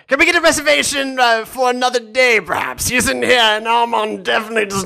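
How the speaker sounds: hammed-up toff voice asking for a reservation; upper class British sheep puppet. Shown as a demonstration. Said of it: "hold on, I can do this".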